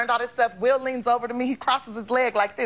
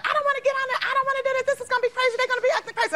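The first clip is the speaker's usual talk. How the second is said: mock complaining; 11 semitones higher, much faster than usual. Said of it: "she was complaining at first".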